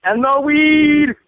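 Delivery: high, slow drawn-out bad Jamaican accent on weed; horrible Jamaican accent. Joking to his cohost, a hammed-up Jamaican accent. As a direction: stretch it out in a mock Jamaican accent. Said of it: "a horrible Jamaican, Irish, Wisconsin accent".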